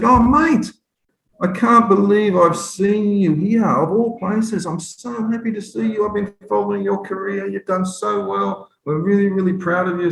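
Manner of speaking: gushing Aussie fan. Retold or imitated. Aussie father meeting him at the airport, high gushing fan. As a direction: lay on the adoring fan.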